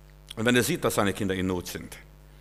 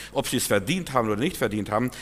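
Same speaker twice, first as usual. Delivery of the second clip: ordinary talk, nothing marked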